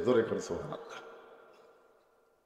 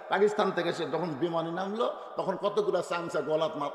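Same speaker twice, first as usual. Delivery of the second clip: ordinary talk, nothing marked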